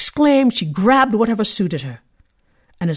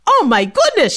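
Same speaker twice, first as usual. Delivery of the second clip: surprised yell; 7 semitones higher, 4 dB louder than usual. Acted. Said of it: "she yelled in surprise".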